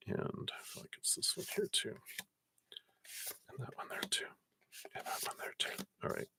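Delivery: hushed, voiceless self-talk; very soft absent-minded whisper. Real, not acted. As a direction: whisper it under your breath while choosing. Self-talk while choosing cards.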